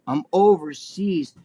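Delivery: slow, stretched-out overseas; drawn-out. Real, not acted. Telling Joe about the long trip, slow and weary. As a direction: drag out overseas in misery. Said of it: "repeated stretched".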